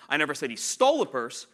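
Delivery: stole punched loud; emphasize stole as the contrasted word; stress on stole. Shown as a demonstration. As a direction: hit stole hard, then fall away. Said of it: "they have six different meanings".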